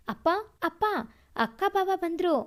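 a mild, happy call from a boy, lightly voiced; joyful shout. Boy telling his father the sister has arrived, mild joyful call. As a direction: call out the good news gladly, not full volume. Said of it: "Ajay ಸಂತೋಷದಿಂದ ಕಿರ್ಚಿದ".

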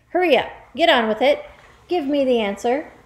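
loud, high, hurried order; impatient demand. Acted. Headmistress presses boy for an answer, impatiently. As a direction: hound him for the answer.

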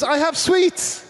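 slow, high, loud comic shout; hammed-up lure from the car window; mock creepy shout. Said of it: "And he goes".

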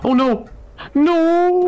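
high, loud panicked cry; panicked shout. Real, not acted. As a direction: yell in sudden alarm. Plane crash about to happen.

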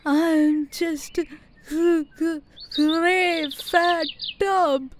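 a sad elephant choking on her words, very slowly; sobbing out each broken word, drawn out; tearful stammer. Acted. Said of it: "while tears rolled down her cheeks".

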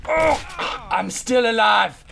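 high, loud survivor's cry; elated shouting. Real, not acted. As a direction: yell in frantic triumph at surviving. Survives, demands victory sound.

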